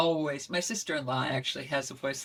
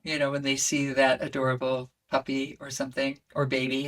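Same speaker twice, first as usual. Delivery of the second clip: ordinary delivery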